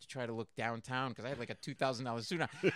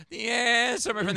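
folk singer; 8 semitones higher, 10 dB louder than usual. Retold or imitated. Folk singer keeps playing.

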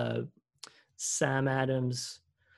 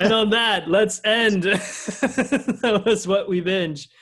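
mock announcer outro; 6 semitones higher, 7 dB louder, much slower than usual. Signing off the podcast.

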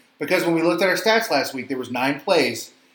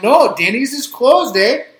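high, loud hammed-up Canadian voice; mock Canadian accent; 9 semitones higher, 7 dB louder, slower than usual. [name] suggests going to Denny's, hammed-up Canadian accent. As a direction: play an eager Canadian caller, pitched up. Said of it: "[name] and Jerry call Canada".

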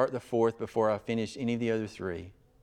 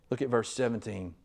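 ordinary talk

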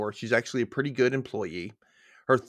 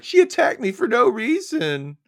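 high, louder, slow whining voice; a hammed-up false accusation; mock whine; 12 semitones higher, 7 dB louder than usual. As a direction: play the liar as a whiny victim. Voicing the lying customer.

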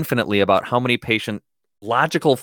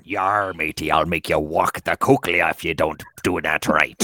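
low, growling pirate threat; 8 semitones lower than usual. Pretend. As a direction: play a rough pirate captain. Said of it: "that's the pirate term".

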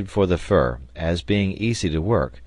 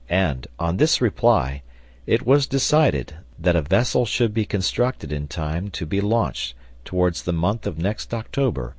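usual speaking voice

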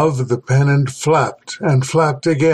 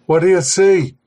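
slightly higher, mildly urgent; exclaimed question; 4 semitones higher than usual. A narrator's reading. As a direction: press for an answer, a touch excited. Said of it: "Travers exclaimed at last".

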